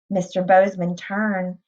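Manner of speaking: ordinary delivery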